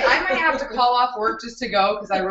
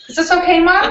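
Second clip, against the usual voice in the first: mock little-kid plea, higher and louder; teasing child; 8 semitones higher, 5 dB louder than usual. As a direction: whine it to mom playfully. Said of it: "I'm not meaning to micromanage".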